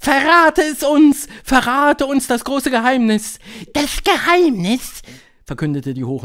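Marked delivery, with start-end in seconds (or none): 0.0-3.4 high, loud eager witches; high-pitched, excited crowd voice; crowd calling out. A narrator's reading. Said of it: "riefen sie". 3.7-5.0 high, slow, loud proclamation; triumphant high witch voice. A narrator's reading.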